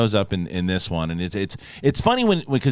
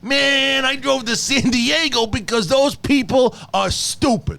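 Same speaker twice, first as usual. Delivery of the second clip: mock New York relative; 9 semitones higher than usual. Voices New Yorker in California.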